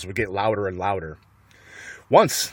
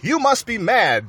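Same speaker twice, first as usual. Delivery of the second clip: shouting; 10 semitones higher than usual. A narrator's reading. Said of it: "the villagers shouted".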